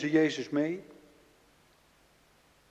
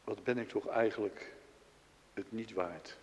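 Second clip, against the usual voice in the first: zacht, gebroken zelfverwijt; 4 semitones lower, 9 dB quieter than usual. Acted. Petrus kijkt naar kruisiging.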